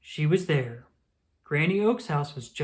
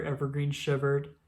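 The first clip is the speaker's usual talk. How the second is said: usual speaking voice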